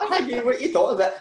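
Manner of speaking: high, fast baffled protest; laughing disbelief; incredulous. Retold or imitated. Retelling a confused reply to Higgy.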